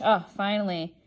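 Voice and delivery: ordinary talk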